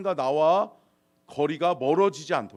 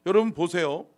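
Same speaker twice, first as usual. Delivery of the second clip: normal talking voice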